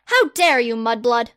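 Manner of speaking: loud, grand, self-important; pompous boy wizard. Acted. Harry the Great loftily turning down a survey, loud and pompous. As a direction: proclaim yourself like a hero.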